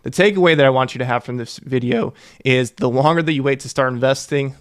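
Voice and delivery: ordinary delivery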